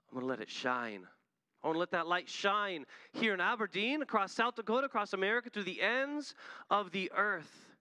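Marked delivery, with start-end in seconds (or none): 1.6-7.8 fervent preaching, climbing in pitch; preaching climax. Acted. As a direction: build to a stirring altar-call peak. Sermon climax building.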